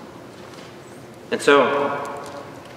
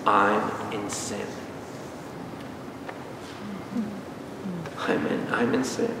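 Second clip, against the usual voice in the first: low, very soft, slow admission; hushed confession; 5 semitones lower, 13 dB quieter than usual. Acted. Preacher acting a failed law-keeper admitting sin, hushed. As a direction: let it sink to a small, defeated near-murmur. Acted realisation after failing the laws.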